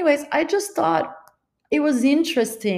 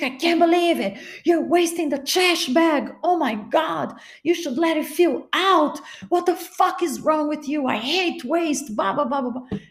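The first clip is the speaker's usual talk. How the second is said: higher, imitating a boyfriend ranting about waste; mock furious boyfriend; 6 semitones higher than usual. Mocking her boyfriend's rant over the trash bag, pitched up. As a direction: mimic his exasperated rant, pitched up.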